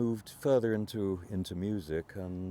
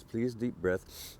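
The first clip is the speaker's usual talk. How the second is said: ordinary delivery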